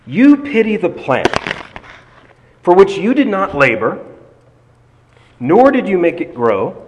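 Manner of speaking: high, loud, measured proclamation; scripture reading as God's rebuke. Acted. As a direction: declaim the verse with long pauses.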